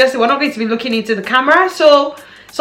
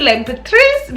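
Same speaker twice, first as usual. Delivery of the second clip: mock French accent; 3 semitones higher than usual. Shown as a demonstration. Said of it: "when I say it that way, I make it sound more French".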